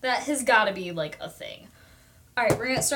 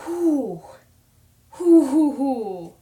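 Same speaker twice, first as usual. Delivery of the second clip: overwhelmed whoo; 3 semitones higher, 9 dB louder, much slower than usual. Retold or imitated. Recalling emotional overload.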